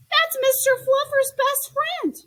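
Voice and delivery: put-on a boy defending his toy; indignant protest. Child protesting toy's removal.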